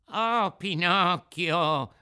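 high, loud, drawn-out wail; whining fox. Acted. Fox begging from the puppet it once cheated, whining. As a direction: cry out like a pleading beggar. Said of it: "gridò la volpe con voce di piagnisteo".

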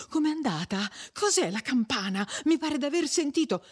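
high-pitched, effortful anxious questions; breathless questioning. A narrator's reading. Agnese, winded, asks the couple how it went, breathless questioning. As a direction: push the questions out, short of breath. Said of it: "cavando fuori la parola a stento".

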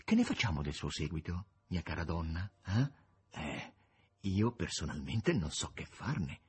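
low, soft, unhurried voice; Voland, weary ruler. A narrator's reading. Voland asks Margherita about her retinue, low and soft. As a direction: speak low and very quietly, with lordly calm.

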